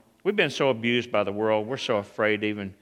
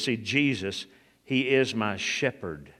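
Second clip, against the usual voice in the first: ordinary talk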